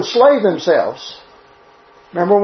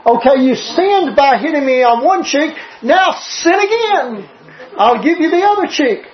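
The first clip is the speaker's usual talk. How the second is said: higher-pitched voice of someone offering the other cheek; mock invitation; 5 semitones higher than usual. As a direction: play an absurd dare to hit once more. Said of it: "What are you telling him?".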